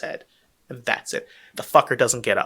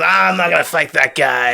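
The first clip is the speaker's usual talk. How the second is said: a louder, put-on voice of a heel refusing to fight; sulking heel wrestler; 3 semitones higher, 5 dB louder than usual. Podcast host mimics a nineties heel dodging a fight, put-on voice. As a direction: play the cowardly heel ducking the match. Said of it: "get out of the ring".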